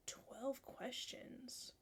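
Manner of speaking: hushed, slow disbelief; barely voiced, taking it in; stunned near-whisper. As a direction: breathe it out slowly, stunned. Stunned reaction to the short test.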